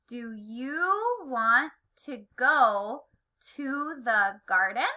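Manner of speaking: slow "Do you want to go to the garden?" with rising "garden"; measured classroom question, "go" and "garden" turned upward; read as a question. Shown as a demonstration. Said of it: "say it like a question".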